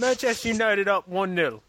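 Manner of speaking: high mock English football fan; English accent. Host riffs on English clichés in a mock English accent. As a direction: cheer for Man United like a Brit. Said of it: "the whole show you talk like that".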